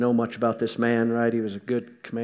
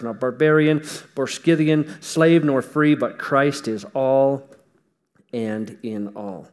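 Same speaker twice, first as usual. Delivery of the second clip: building scripture proclamation; 5 dB louder than usual. Acted. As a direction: read the verse building to a climax. Climactic scripture reading.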